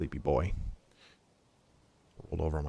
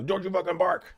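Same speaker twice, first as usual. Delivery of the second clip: pitched-up mock scolding yell; hammed-up snap at the dog; a mock-angry owner yelling at the dog; 10 semitones higher than usual. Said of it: "like, yell".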